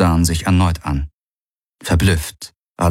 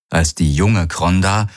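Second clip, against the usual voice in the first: usual speaking voice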